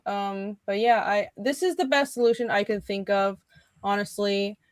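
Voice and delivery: usual speaking voice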